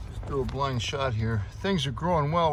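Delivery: ordinary talk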